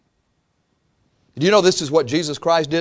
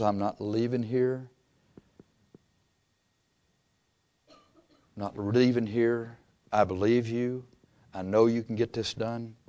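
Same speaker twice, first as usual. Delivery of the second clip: much softer, low, resolute pleading; quiet determined prayer; 5 semitones lower, 10 dB quieter than usual. Retold or imitated. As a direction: hold on to God, hushed and firm.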